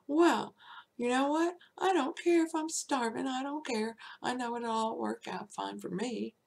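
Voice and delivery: defiant quitting. Retold or imitated. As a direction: throw it in their faces.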